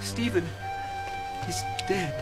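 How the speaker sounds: hushed, halting grief; grief-stricken. Acted. Messenger telling friends of Stephen's death, grieving. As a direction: break the death softly.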